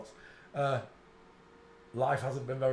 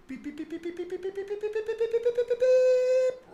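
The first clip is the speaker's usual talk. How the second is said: beeps climbing steadily higher, getting louder at the end; mock detector beeping; 5 dB louder than usual. Pretending to be a scanner zeroing in on the crotch, rising beeps. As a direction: beep like a scanner closing in on the target.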